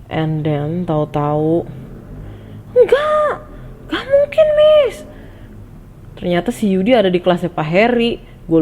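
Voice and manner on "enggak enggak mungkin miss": very high, loud cry of denial; teriak tak percaya. Retold or imitated. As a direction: shriek it in disbelief, imitating an upset pupil. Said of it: "dia tuh teriak-teriak". (berteriak histeris menolak percaya)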